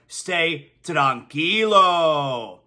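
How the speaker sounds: stretched out slow and easy, Stay tranquilo; drawn out slow, Stay tranquilo, very relaxed; laid-back mantra. Acted. Host closes the ad with the brand mantra, slow and relaxed. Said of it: "Johnny Cuba's mantra".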